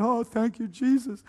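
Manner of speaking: high-pitched, mildly tearful gratitude; retold tearful thanks. New convert retelling how he cried in prayer, mildly tearful. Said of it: "but I was crying".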